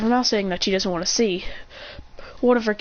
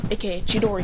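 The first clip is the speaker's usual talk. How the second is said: plain reading voice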